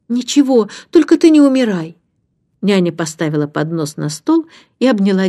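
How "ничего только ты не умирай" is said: put-on high-pitched worried child; anxious plea. A narrator's reading. Child still fearing for nanny, anxious plea.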